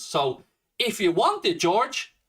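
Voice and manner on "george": ordinary talk, nothing marked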